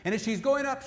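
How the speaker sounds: ordinary talk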